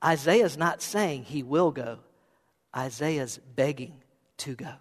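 deliberate, with a pause midway; slowed-down repeat. Shown as a demonstration. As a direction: say it slowly and quietly so it sinks in. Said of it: "Maybe I said that too fast".

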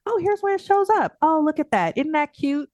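put-on amused baby-talk to herself; mock cooing. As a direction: tease yourself fondly in a high sing-song. Cooing at her own habit.

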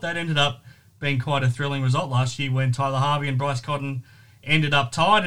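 ordinary talk, nothing marked

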